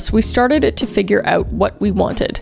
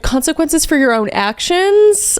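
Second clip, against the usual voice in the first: higher, drawn-out mock outrage; sarcastic shock; 6 semitones higher, slower than usual. Reader mocking poster facing consequences, sarcastic shock. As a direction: gasp it slowly with feigned astonishment.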